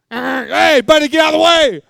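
high, loud hammed-up yell at someone in the way; loud mock road rage; mock shout. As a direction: bark at a driver blocking you. Mock driver shouting in traffic.

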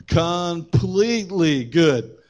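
"completely" stretched out very slowly; emphatic stress. Real, not acted. Preacher making his first point, slow emphasis. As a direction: draw out "completely" for weight.

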